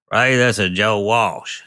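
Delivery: high, slow, drawn-out phone greeting. Retold or imitated. Rock star phones the songwriter out of nowhere, announcing himself.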